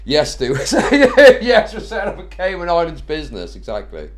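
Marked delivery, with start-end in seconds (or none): 0.6-1.4 loud laugh. Real, not acted. Laughs at a viewer's joke.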